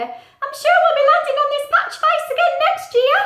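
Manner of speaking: high, loud chirpy bird voice; little bird voice. Acted. Little bird greeting Pete, high and loud. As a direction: squeak it out like a tiny bird. Steve calls flying away.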